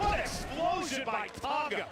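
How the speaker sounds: high, excited call. Real, not acted. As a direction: call the big hit with excitement. Big move in live match.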